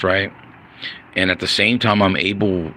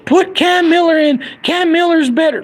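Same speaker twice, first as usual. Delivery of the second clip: squeaky high mocking fan voice; high mocking fan squeal; mocking dumb fan. Mocking dumb Raider fans.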